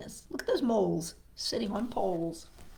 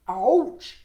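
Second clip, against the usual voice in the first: a sudden loud yelp of ouch; cartoon frog crying out; mock ouch; 7 dB louder than usual. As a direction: yelp it as if stung. Frog reacts to the pain.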